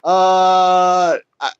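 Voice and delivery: long high "uh", halting start, rising finish; mock hesitation. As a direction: stammer into an unsure question. Acting out coach's unsure answer.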